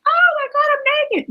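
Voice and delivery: high squealed oh my God; mock shock. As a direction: play it as a startled shriek, hammed up. Startled by message in shower.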